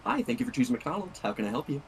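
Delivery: sweet fast-food counter greeting; customer service voice. Shown as a demonstration. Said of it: "I changed the pitch of my".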